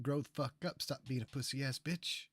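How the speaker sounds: usual speaking voice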